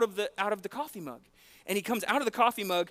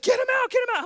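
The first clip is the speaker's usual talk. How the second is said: panicked wife; 7 dB louder than usual. Retold or imitated. Wife urges him, voiced in retelling.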